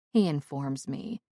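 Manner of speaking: ordinary delivery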